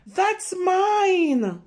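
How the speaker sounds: high loud possessive yell; angry shout. Acted. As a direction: claim it back at the top of your voice. Brother shouts over treats.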